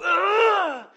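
sudden loud high grunt of pain; a sharp yelp of hurt; pained cry. Acted. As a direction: cry out as if struck by a hammer. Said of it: "hit you with a hammer and watch you go".

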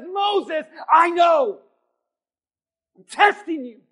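high, booming preacher's shout; God. Acted. God answering Moses's complaint, loud emphatic shout. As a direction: shout the answer back with full authority. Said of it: "And God says".

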